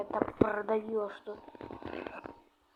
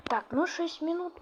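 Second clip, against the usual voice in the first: ordinary talk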